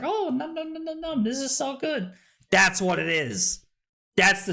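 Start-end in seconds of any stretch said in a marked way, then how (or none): 0.0-2.1 mock yummy delight. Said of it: "and then it's like".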